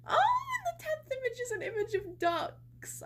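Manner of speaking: high, loud excited gush; delighted squeal. Real, not acted. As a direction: squeal happily over the ducks. Delighted by duck picture.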